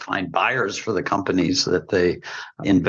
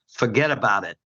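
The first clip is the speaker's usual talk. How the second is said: a light, jokey Brooklyn accent on the brush-off. Shown as a demonstration. Closing his panel point with a Brooklyn brush-off, a touch of Brooklyn accent. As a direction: toss it off like a Brooklyn local, just a hint of the accent. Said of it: "I'm gonna do my best Brooklyn accent here".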